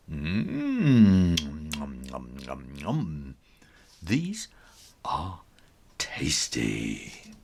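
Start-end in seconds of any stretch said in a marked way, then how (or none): 0.1-3.4 smack it up greedily; greedy munching. Acted. Bully munches the stolen treats.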